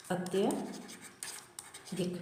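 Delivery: अत्यधिक drawn out very slowly and softly; slow spelled-out word. Shown as a demonstration. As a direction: stretch अत्यधिक syllable by syllable, quietly. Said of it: "तो अत्यधिक आएगा".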